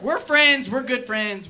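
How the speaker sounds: loud insistence. Real, not acted. Shouting reassurance after a heckle.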